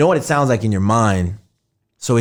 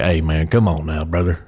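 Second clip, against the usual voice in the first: Texan accent; 7 semitones lower than usual. Shown as a demonstration. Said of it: "Be like".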